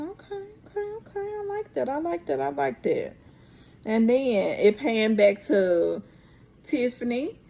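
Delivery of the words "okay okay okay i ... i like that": squeaky high voice, much higher and softer; playful falsetto reaction; mock high-pitched reaction. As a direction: gush it in a tiny high voice. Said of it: "I said".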